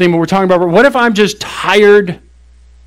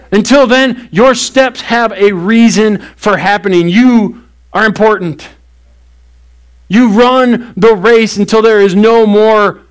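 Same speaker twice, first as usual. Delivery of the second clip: higher, slow and insistent; rising preaching; 3 semitones higher, slower than usual. Acted. Pastor urging tired listeners to keep running, rising and slow. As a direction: lift it up and press each phrase home. Preaching climax, rousing the congregation.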